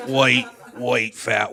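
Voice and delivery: ordinary talk, nothing marked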